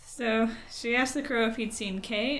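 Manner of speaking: ordinary talk, nothing marked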